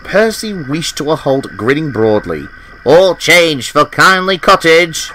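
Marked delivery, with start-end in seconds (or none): 2.8-5.1 slow, higher station-style call; best express engine voice. A narrator's reading. Percy calls out to passengers in his express engine voice. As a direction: call it out grandly and slowly. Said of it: "in his best express engine voice".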